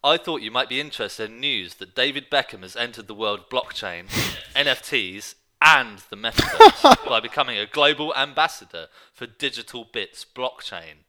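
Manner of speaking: reading a press release aloud, breaking into laughs; can't keep a straight face while reading it; amused read-out. Real, not acted. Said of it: "let me read it out".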